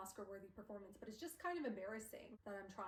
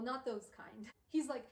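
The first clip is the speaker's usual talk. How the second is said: ordinary delivery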